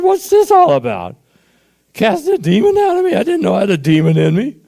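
high, loud disbelieving outburst; reliving the stunned surprise, voice shooting up; what, me, a demon?; incredulous shock. Retold or imitated. Shocked at having a demon cast out.

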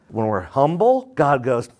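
ordinary talk, nothing marked

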